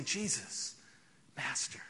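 the quiet way they could not use; quietly. Shown as a demonstration. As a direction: say it too quietly to be heard. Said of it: "They couldn't come up and say".

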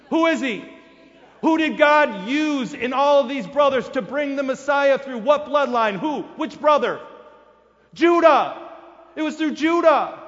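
loud, high, hammering questions to the crowd; preaching at full force. Acted. Preacher to congregation, demanding who carried the bloodline, at full force. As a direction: drive each question home like a revival preacher.

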